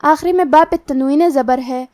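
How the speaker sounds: ordinary delivery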